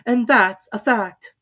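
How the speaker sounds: high, slow, imperious lady; grand and dismissive; Duchess. A narrator's reading. Duchess figure voice.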